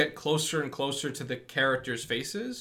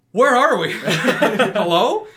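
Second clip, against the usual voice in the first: much higher, loud, laughing bewilderment; confused laughing; 8 semitones higher, 12 dB louder, slower than usual. Retold or imitated. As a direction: laugh through a baffled mock call. Mocking the jarring movie cut.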